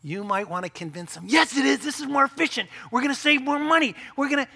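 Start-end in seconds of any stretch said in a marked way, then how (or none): 1.3-4.5 higher, pushy mock argument; insistent spouse. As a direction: press your point as the know-it-all. Spouse arguing to save money.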